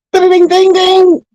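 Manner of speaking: high sung ding of a boxing bell; bell imitation. Announcing the fight start like a boxing bell. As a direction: ring the fight bell in your voice.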